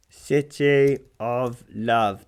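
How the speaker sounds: slow, loud, word-by-word reading out; measured spoken phrase, each word separate. Real, not acted. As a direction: say it slowly as if typing it in. Spelling out a search while talking.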